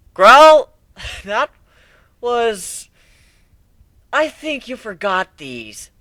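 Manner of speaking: put-on high, excited young colt; a bright kid pony, awed then teasing; young pegasus voice. Narrator voices Whiplash.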